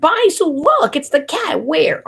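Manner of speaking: high squealing excitement; excited alert. Retold or imitated. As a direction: call out about the cat, thrilled. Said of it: "And it's like".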